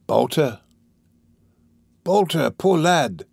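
drawn-out, deliberate pitying call; slow, marked emphasis. A narrator's reading. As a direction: linger on the words with relish. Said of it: "speaking slowly and with marked emphasis".

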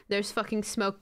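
normal talking voice

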